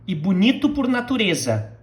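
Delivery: "E bonito por natureza" run together fast; normal pace. Shown as a demonstration. Teacher models the line at normal speed. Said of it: "in a normal, uh, pace".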